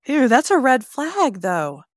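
loud, high disgusted ew. Real, not acted. Reacting with disgust to the sweaty stranger.

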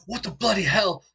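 high, loud outburst of shock; freaking out. Acted. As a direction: burst out in startled British-style disbelief. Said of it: "he's freaking out".

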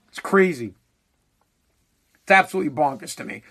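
ordinary talk

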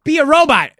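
high, loud hammed-up demand; mock screaming. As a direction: bellow it at him, overplayed. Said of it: "We're screaming at him".

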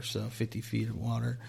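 normal talking voice

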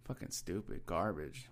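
ordinary delivery

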